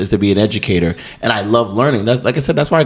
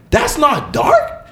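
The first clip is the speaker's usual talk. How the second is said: high, slow disbelief; 12 semitones higher, much slower than usual. Retold or imitated. His reply in retold session.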